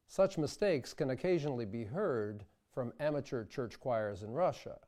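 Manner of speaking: usual speaking voice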